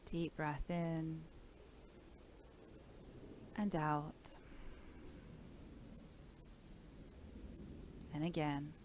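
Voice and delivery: calm, measured body-scan voice with long pauses; guided meditation. Real, not acted. Teacher guides listeners through breath and body awareness, calm meditation voice.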